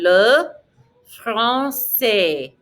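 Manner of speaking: LE, pause, FRANÇAIS with no final S; spaced-out LE FRANÇAIS, final S dropped; silent final S. Shown as a demonstration. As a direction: model FRANÇAIS slowly, ending on the vowel. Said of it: "Don't pronounce the S".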